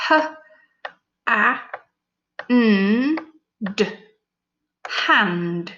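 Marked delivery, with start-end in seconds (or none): 0.1-3.9 each sound said separately; sounding out letters. Shown as a demonstration. Teacher segmenting hand for pupils. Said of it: "I can add my sound buttons".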